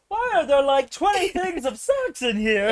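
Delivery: high, loud incredulous question; mock bewildered outburst. Camper finding socks in the sleeping bag, high loud mock bewilderment. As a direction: shoot the pitch way up in disbelief.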